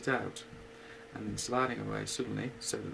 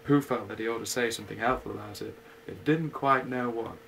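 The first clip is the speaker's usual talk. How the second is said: usual speaking voice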